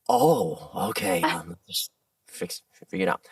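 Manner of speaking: mock hesitant, deflated reply; stumped director. Director stumped by actor's low voice, hesitant.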